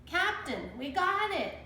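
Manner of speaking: high excited pirate shout. A narrator's reading. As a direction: call out to the captain. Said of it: "shouted Pinky".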